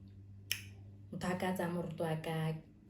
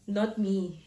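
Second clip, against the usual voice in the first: loud refusal; 2 semitones higher, 8 dB louder than usual. Retold or imitated. Refusing the drip.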